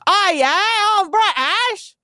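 loud, high-pitched mock British; hammed-up British accent, swooping high; badly faked British, proudly overdone. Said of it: "Did I nail that accent?".

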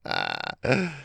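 soft sly laugh; sly chuckle. Acted. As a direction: chuckle like a sneaky trickster. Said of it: "This made the impostor laugh".

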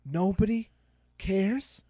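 an old man's voice with a hint of Scottish; mild Scottish brogue, slow and weary; slow, lightly Scottish grumble; light Scottish accent.

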